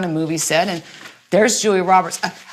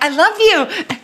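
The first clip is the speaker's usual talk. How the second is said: high, gushing I love you; gushing fan; 12 semitones higher, slower than usual. Retold or imitated. Starstruck actress gushing over meeting Julia Roberts, high and giddy. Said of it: "this moment that I'm like".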